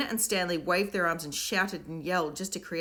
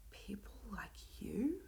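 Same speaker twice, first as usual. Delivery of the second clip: slow, soft breathless whisper; 5 semitones higher, 14 dB quieter, much slower than usual. A narrator's reading. Will whispers back to Myron in disbelief, slow. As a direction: hush it in disbelief. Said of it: "whispered Will".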